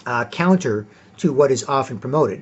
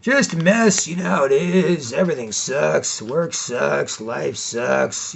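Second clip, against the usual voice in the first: whiny guy; much slower than usual. Retold or imitated. A guy whining to friends that life sucks. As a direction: lightly whine about how everything sucks. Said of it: "Guys go".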